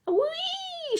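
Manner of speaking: very high squealing whee; hen's shriek. A narrator's reading. The hen shooting up into the air, a high shriek. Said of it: "shrieked the hen".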